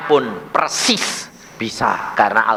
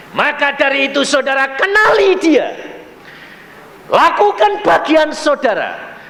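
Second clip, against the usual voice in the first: high, loud preacher's command; raised-voice pulpit exhortation; forceful exhortation; 12 semitones higher, 4 dB louder than usual. Real, not acted. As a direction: urge the congregation at full force.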